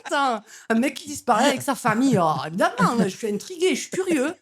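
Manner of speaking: hammed-up Marseille accent, climbing up. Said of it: "tu peux faire ton accent".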